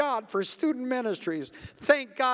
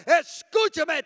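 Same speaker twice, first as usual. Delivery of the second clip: rebuking preacher shout; 6 semitones higher than usual. Retold or imitated. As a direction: cry out the rebuke with full force. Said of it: "and he'd say".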